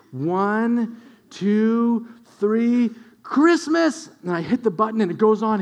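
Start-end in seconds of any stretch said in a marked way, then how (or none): 0.1-4.1 put-on toddler's countdown. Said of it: "He's like".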